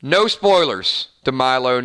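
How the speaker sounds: ordinary talk, nothing marked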